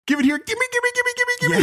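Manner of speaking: very high, greedy cartoon villain; Krang impression. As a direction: squeal it as a frantic, grabby demand. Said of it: "Krang goes / I can't do the voice".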